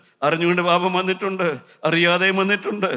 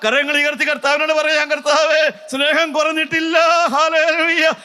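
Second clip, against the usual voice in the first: building from raised preaching to a high shout; climactic preaching; 11 semitones higher, 5 dB louder than usual. Acted. Preacher leading hands-raised vow to the Lord, building to a shout. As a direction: lift the crowd into a shouted vow.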